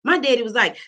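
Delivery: normal talking voice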